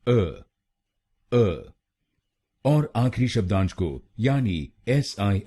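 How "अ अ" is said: अ said as a short neutral "uh"; a clipped, weak "uh" for अ; syllable demo. Shown as a demonstration. A teacher modelling the third syllable, अ as "uh". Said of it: "Y हम बोलेंगे".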